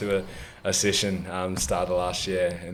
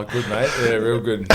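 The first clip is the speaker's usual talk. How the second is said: ordinary delivery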